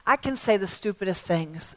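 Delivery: ordinary talk, nothing marked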